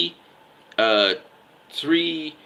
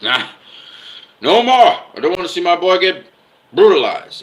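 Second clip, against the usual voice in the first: mock high, loud cry to stop; pleading father; 8 semitones higher, 7 dB louder than usual. Father pulling his son from the fight, mock outcry. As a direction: imitate an alarmed father, voice pitched up and loud. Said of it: "He was like".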